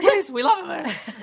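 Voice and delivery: high squeaky laughing plea. Real, not acted. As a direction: beg through giggles.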